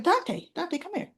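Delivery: high, hushed imitation of calling someone over; Christina summoning Dante. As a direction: call him back urgently but softly. Retold discreet calling back of Dante.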